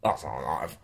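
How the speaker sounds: gargly, very low and soft Australian; Kevin. Shown as a demonstration. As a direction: swallow it low in the throat, mock Australian. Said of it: "it just becomes gargly".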